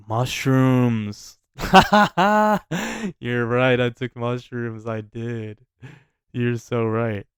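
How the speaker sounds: loud burst of laughter then laughing agreement; laughing realization. Retold or imitated. Stoned guy laughing as his girlfriend says he took mushrooms. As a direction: crack up at the revelation and laugh through it.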